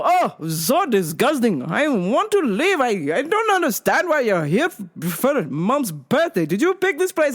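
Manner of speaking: high, sing-song disgusted dad; mock a fussy dad's disgust; complaining dad. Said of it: "my dad's".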